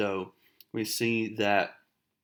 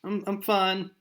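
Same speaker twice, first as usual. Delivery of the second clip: high, tearful put-on girl's voice; mock crying; 9 semitones higher than usual. Heartbroken girl insisting to her friend, mock crying. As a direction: pretend to cry while insisting on it. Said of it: "She starts crying. She said".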